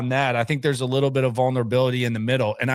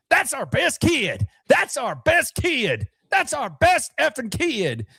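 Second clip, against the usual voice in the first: much higher, slow mock-yelled chant; Chris Ballard screaming; 12 semitones higher, slower than usual. Host imitating the general manager yelling after the ejection. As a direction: ham up the GM's outraged cry. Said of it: "Just screaming".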